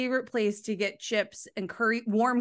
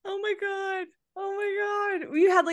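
amazed; 10 semitones higher than usual. Real, not acted. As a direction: stretch it out in delighted disbelief. Reacting to friend's yogurt story.

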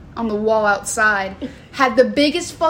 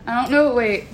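usual speaking voice

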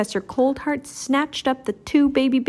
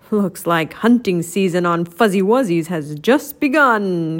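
gloating villain voice, a touch louder; cackling villain; 3 semitones lower, 4 dB louder than usual. A narrator's reading. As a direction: sneer it out like a cold-hearted villain. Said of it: "he cackled".

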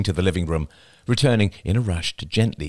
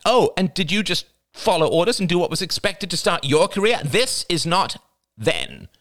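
snapping aunt; 11 semitones higher than usual. A narrator's reading. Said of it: "snapped Crystal".

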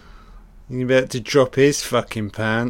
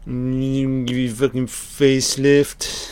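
mock killer voice; 2 semitones higher than usual. Mocking the film's killer.

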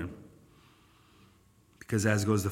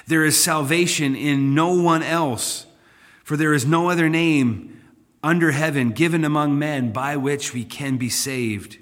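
1 forceful scripture reading; 2 semitones higher, 5 dB louder than usual. Acted.

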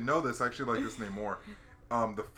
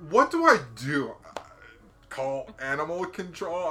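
puzzled guy unsure who to phone; clueless caller; 4 semitones higher, 8 dB louder than usual. Retold or imitated. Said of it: "He's like".

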